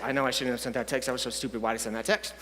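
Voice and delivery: low, soft muttering; inner self-reproach. Acted. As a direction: berate yourself under your breath. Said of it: "Voice comes back".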